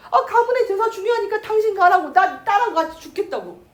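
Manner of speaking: high, loud defiant retort; defiant mother. Retold or imitated. Mother telling husband to go alone, high and defiant. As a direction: throw his words back at him.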